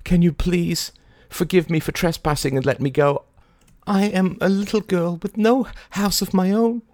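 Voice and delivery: put-on very high, childlike pleading; mock pleading little girl. A narrator's reading. Mary pleading.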